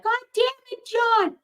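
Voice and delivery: high squeaky imitation of an angry wife; mock Karen. Imitating Karen cursing at John, high-pitched. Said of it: "Karen's like".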